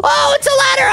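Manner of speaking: high-pitched thrilled squeal; hammed-up high excitement; excited discovery. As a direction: squeal at a lucky find. Discovers the escape route.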